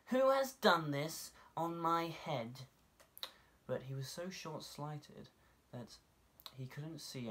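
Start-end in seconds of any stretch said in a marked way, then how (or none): 0.1-2.7 higher, louder, slow, offended voice; indignant little mole. A narrator's reading. Mole asks who soiled his head, slow high indignant voice. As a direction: demand it slowly, high and hurt.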